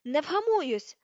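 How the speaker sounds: defiant shout; angry yell. A narrator's reading. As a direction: yell back, refusing to calm down. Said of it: "закричала вона".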